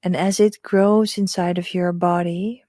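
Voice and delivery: hushed guide leading breath and visualization; hypnotic meditation voice. Acted. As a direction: lull them gently into calm. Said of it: "that's my hypnotic voice".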